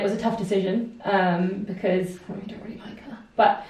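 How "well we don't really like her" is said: whispered aside. Real, not acted.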